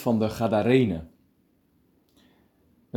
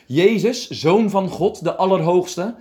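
high, loud cry of desperate pleading; luide schreeuw; 9 semitones higher, 5 dB louder than usual. A narrator's reading. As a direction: cry out loudly, pitched well up. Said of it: "met luide stem schreeuwde hij". (luid en hoog uitgeroepen)

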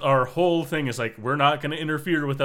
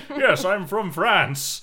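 mock British accent, higher and very slow; Jean-Luc Picard; 5 semitones higher, 4 dB louder, much slower than usual. Joking about the French captain who sounds British, mock British accent. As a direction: draw it out in hammed-up British. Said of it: "the most British Frenchman ever".

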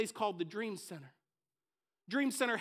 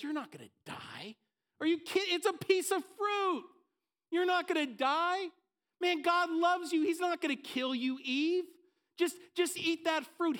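tempting serpent; 7 semitones higher than usual. Acted. Preacher voicing the serpent tempting Eve, high and pressing. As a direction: coax her like a sly serpent.

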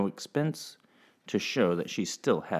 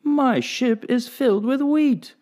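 high, slow, beaming announcement; captain delighted with his cargo; joyful; 5 dB louder than usual. Acted. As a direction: savor the good news. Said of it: "cried the captain".

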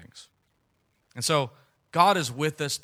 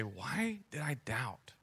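whispered regret; 10 dB quieter, slower than usual. Acted. Looking back on life.

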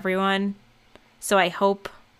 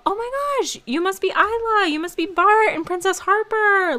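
high, bubbly excited greeting; overjoyed welcome at the door; excited cast member greeting; 12 semitones higher, 3 dB louder than usual. Retold or imitated. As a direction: gush like a thrilled host meeting guests.

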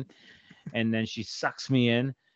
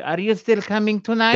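higher, louder mock mother voice; imitating mom; 8 semitones higher, 5 dB louder than usual. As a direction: put on mom's phone voice. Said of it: "Thank you. Much better".

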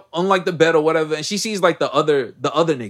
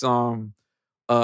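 ordinary delivery

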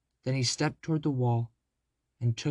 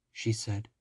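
ordinary delivery, nothing marked

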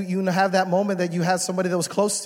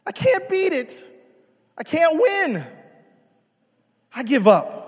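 put-on high, whiny kid giving up; son's despair; 9 semitones higher, 6 dB louder than usual. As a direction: sound like a little boy defeated by a game. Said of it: "He goes".

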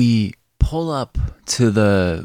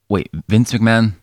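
ordinary delivery

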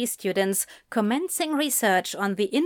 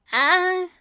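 higher-pitched hesitant journalist; stuttering hesitation; 9 semitones higher than usual. Acted. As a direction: falter before answering. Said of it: "the journalist stuttered".

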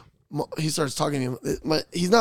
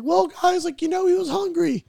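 high, louder mock-grandma voice; defensive grandma; 3 dB louder than usual. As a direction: make excuses in a high imitated grandma voice. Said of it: "And she's like".

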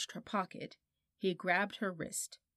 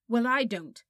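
sharp, louder cross retort; 4 semitones higher, 9 dB louder than usual. A narrator's reading. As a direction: snap back at him, annoyed. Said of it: "said Polly crossly".

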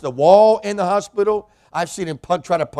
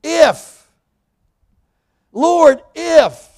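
much higher, loud cry of Lord; yelling out; 11 semitones higher, 10 dB louder, much slower than usual. Retold or imitated. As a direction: call out desperately from the boat. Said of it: "He yells out".

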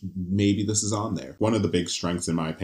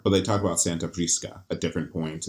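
ordinary talk

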